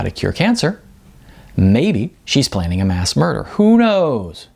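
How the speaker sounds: put-on a lively young man joking about a strange neighbour; chatty young Sean. Sean describing Icon to roommate.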